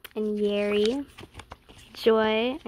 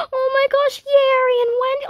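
much higher, loud screaming gush; fangirl shriek; 12 semitones higher, 8 dB louder than usual. Real, not acted. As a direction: scream with joy at the photo. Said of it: "I'm trying not to scream".

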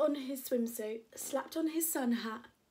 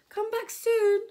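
high, loud farewell call; shout; 11 semitones higher, 12 dB louder than usual. Acted. Max calling after Preston leaving, high loud shout. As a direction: yell it after a departing friend. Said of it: "shouted Max".

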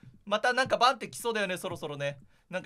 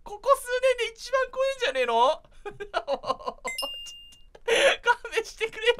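shaken laughter; 13 semitones higher, 5 dB louder than usual. Real, not acted. After jump scare, laughing.

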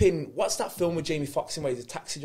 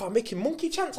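higher, lightly imitated broken-English accent; 6 semitones higher than usual. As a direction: quote him in broken English, pitched up. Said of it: "in a broken accent".

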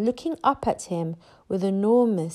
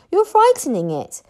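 higher, louder scolding; impatient; 9 semitones higher, 5 dB louder than usual. A narrator's reading. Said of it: "said Hiccup impatiently".

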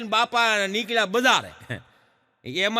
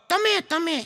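put-on child voice; 10 semitones higher than usual. Schoolboy answers teacher.

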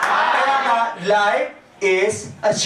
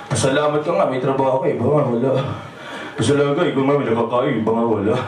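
much lower, grumbling resigned voice; a deep, defeated mutter of someone settling for less; resigned grumble; 9 semitones lower, much faster than usual. Retold or imitated. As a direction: drop low like a man who has given up. Quoting a resigned poor man's mindset.

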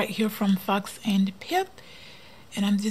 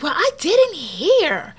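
rude lady; 7 semitones higher, 5 dB louder, slower than usual. Retold or imitated. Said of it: "And then she was like".

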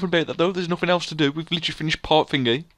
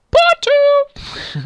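sudden very high, loud held notes; silly high squeal; 8 dB louder than usual. Acted. Player ending the outro, comic high squeal. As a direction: leap up into a piercing falsetto. Grand finish to narrated outro.

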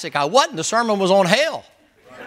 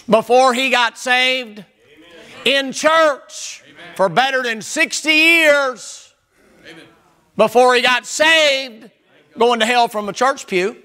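preaching shout; 4 semitones higher, slower than usual. Real, not acted. Preacher at full force.

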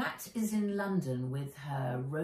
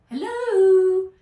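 high, loud bright call to get his attention; cheeky girl's hello; 14 semitones higher, 15 dB louder than usual. A narrator's reading. Nat startles her guardian from behind: loud, high hello. Girl calls to distracted guardian.

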